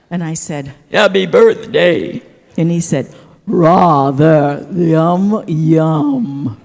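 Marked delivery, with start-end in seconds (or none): 0.9-2.2 loud, drawn-out, mock breathy; slow sultry Happy birthday. Dancer teasing the duke, slow sultry loud. Joke line while dancing with duke. 3.5-6.6 loud, plummy posh voicing; mock British duke. Duke replying to his dance partner, mock plummy British.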